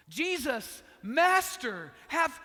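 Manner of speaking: loud, high desperate cry to Jesus; retold yell. Ten lepers yelling to Jesus from afar, loud desperate plea. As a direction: shout it across the distance, begging. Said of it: "They yell out".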